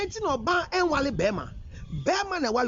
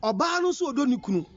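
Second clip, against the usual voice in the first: usual speaking voice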